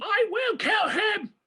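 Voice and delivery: high, drawn-out mock shout; imitated furious shout. Host playing the taunted hero in the duel, mock furious shout. Quoting hero's furious reply.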